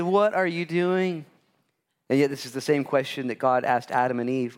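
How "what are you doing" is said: slow, louder, higher accusing; busting someone. Retold or imitated. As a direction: drag out the question in mock accusation. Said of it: "I have been so busted".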